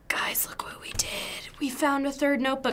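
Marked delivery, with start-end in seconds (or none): 0.1-1.1 excited whisper. Real, not acted. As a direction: hush it out thrilled. Secret win while hiding.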